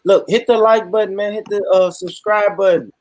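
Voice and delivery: rally the viewers; a streamer urging viewers to like and subscribe, hyped; hype outro. Real, not acted. End of stream appeal.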